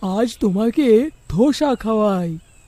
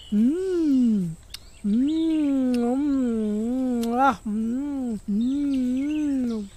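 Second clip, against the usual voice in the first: long, soft mmms of relish; savouring hums; 5 dB quieter, much slower than usual. Acted. Villager tasting the dosa, drawn-out savouring hums. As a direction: hum slowly over a delicious mouthful.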